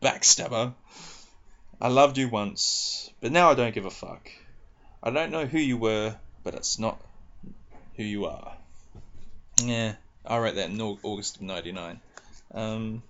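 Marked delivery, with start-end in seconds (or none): 0.0-8.5 poem reading. Acted. Reading own poem aloud.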